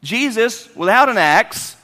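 usual speaking voice

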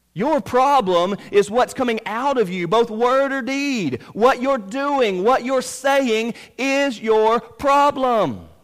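high, loud, drawn-out preaching; full-force preaching. Real, not acted. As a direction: drive each phrase home, high and loud.

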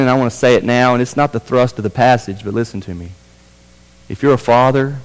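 ordinary talk

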